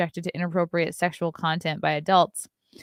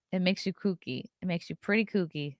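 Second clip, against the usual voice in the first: ordinary talk, nothing marked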